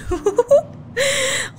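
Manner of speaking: high, cry-like laugh of relief; high relieved laugh. Real, not acted. Gamer finishes the hard mission, high tearful laugh. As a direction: laugh out the tension of winning.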